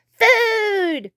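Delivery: high, drawn-out cries for food; high mock-thundering shout. A narrator's reading. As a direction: demand food like a greedy little dinosaur. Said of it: "thundered Tiny".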